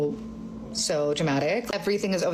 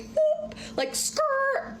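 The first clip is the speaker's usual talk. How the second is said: brake screech sound; much slower than usual. Retold or imitated. Abrupt stop, brakes imitated.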